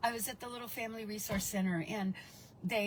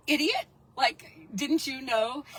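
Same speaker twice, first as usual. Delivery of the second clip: mock scolding; 3 semitones higher, 7 dB louder, slower than usual. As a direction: scold her like she should have known. Imagined townsfolk scolding her.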